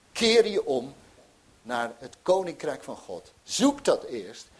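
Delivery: loud, urgent call to turn around; fervent preaching. Acted. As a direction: preach it out, pleading. (vurig preken, luid en dringend)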